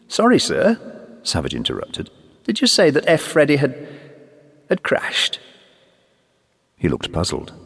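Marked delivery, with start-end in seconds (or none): 0.1-0.8 put-on higher, eager young airman. 2.4-5.4 put-on higher, eager young airman. Young airman asks, shocked.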